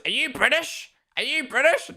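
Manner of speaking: high, far-off mocking "are you British" voice; mock American gamer. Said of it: "it's always".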